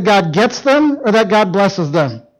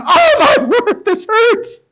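pained cry; much slower than usual. Retold or imitated. Husband to his wife in the bedroom, pained high cry. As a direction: wail about the broken wrist. Complaining of wrist every night.

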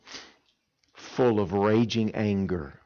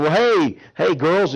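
higher, louder bright hello; cheerful hey girls; cheery greeting; 7 semitones higher, 7 dB louder, slower than usual. Retold or imitated. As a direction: greet them warmly and loud.

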